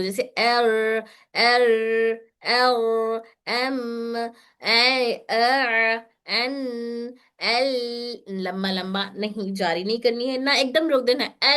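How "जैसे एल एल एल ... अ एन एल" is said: "एल" stretched long and slow; teacher shows the over-stretched way; letters drawn out. Shown as a demonstration. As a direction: demo stretching "एल" too long. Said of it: "मैं जारी करके दिखाती हूँ".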